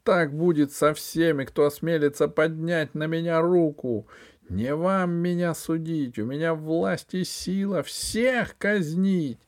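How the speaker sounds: high, loud menacing rant; raging threat. A narrator's reading. Usurper threatening the whole hall, raging. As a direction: threaten them all in rage.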